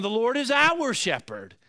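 "our" pushed up and stressed, falling end. Shown as a demonstration. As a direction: lean on "our" in "The Lord is our shepherd". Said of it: "or even".

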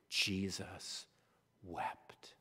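soft, hushed, slow reading of the verse; hushed reverent. Shown as a demonstration. Preacher reading the shortest verse, hushed. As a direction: let it fall to a hush. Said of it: "shortest verse, said softly".

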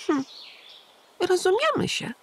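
put-on high childlike wondering question; a small boy amazed; curious little hedgehog. A narrator's reading. Little Igiełka asking the pigeon, high child voice. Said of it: "potaknął Igiełka".

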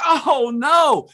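high, loud mock dismay; imitating horrified wife. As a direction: wail it like a mortified hostess. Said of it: "Julie is horrified".

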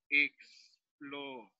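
"exploración" slowly broken into ex-plo-ra-ción; syllable by syllable. Shown as a demonstration. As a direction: say "exploración" with a long pause after each syllable. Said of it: "se reparten las consonantes para cada sílaba".

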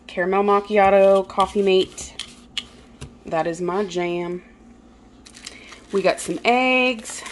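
ordinary delivery

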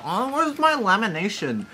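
high, snooty complaining tone; snobby voice. Retold or imitated. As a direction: play a fussy food snob. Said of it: "snobby about".

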